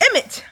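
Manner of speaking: "Emmett" popped out high and loud; pronunciation demo. Shown as a demonstration. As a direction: punch out "Emmett" as a name being sounded out. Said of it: "I wanna pronounce it as".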